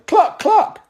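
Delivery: clucking yell. A narrator's reading. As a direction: taunt the flock with a shrill clucking. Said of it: "Grover yelled".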